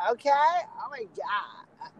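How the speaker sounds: put-on high, slow, drawn-out girly gush; over-peppy girl. Imitating girls with too much pep, high mock voice. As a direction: squeal it slowly like a too-perky girl. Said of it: "a little bit too much pep".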